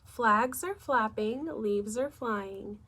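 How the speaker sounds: usual speaking voice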